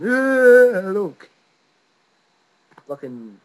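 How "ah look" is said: high, loud panicked wail, fading on look; hysterical. Retold or imitated. A boy showing dad his bloody chin, panicked wail. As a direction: show dad the wound in a panic. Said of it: "just all hysterical".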